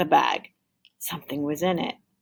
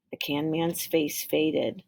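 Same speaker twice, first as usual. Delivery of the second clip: usual speaking voice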